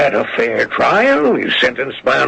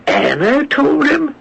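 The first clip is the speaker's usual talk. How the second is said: 1 stunned disbelief. Acted. Prosecutor stunned by revelation.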